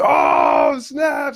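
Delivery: loud drawn-out mock group whoop; rowdy crowd reaction. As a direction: shout it like friends erupting at a burn. Group erupts at Tony's line.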